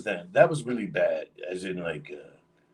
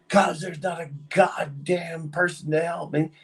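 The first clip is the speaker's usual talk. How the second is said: higher, exasperated comeback; retorting in anger; 8 semitones higher than usual. Retold or imitated. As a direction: snap back at the boss. Arguing back at the manager.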